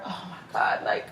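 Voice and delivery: breathy whisper of emotion; barely voiced, overwhelmed; tearful whisper; whispered, moved. Real, not acted. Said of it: "tears are coming to my eyes".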